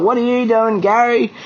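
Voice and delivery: high-pitched mock complaint; retold whingeing. Angler imitating blokes griping at the old fella, mock whinge. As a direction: imitate moaning mates, voice pushed well up. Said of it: "other blokes are whinging".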